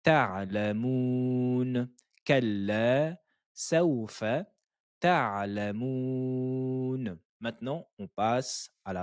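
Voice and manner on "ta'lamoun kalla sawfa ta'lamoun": ta'lamoun very slowly, syllable by syllable with the Ain. Shown as a demonstration. Teacher drilling students on ta'lamoun, slow and careful. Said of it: "N'oubliez pas la lettre N".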